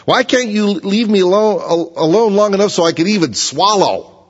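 high, loud, desperate plea; pleading cry. Acted. Job begging God to leave him alone, high and loud. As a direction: beg God to let up, voice straining high.

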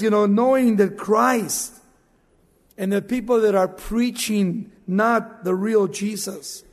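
ordinary talk, nothing marked

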